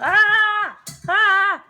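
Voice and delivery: high, loud excited squeal. Real, not acted. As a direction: squeal with real delight. Mom turning fifty.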